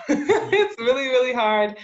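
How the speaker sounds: laughing through the words. Real, not acted. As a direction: laugh it out as you say it.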